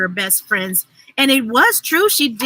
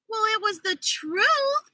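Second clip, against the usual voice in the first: satisfied pronouncement; 9 semitones higher, 6 dB quieter than usual. A narrator's reading. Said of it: "Libby pronounced, feeling satisfied".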